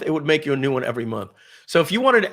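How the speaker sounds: usual speaking voice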